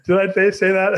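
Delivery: high-pitched question through laughter; laughing. Real, not acted. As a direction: laugh while playing innocent. Teased about persuading, laughs along.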